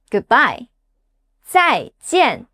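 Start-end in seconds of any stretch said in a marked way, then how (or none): 1.5-2.5 "Zaijian." each syllable high, then falling; fourth tone. Shown as a demonstration. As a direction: drop both syllables of "Zaijian." sharply. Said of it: "The fourth tone drops fast".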